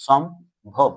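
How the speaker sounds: সম্ভব split as "shom, bhob"; two separate beats in সম্ভব, pause between; syllable by syllable. Shown as a demonstration. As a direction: break সম্ভব after the m. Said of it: "এভাবে তো উচ্চারণ করছি".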